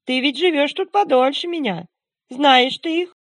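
high, loud chattering snowman; chattering melting snowman voice. Acted. Snowman asks the dog.

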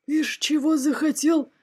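high, slow indignant dragon voice; hammed-up monster outcry; crying dragon head. A narrator's reading. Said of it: "закричала одна из драконьих голов".

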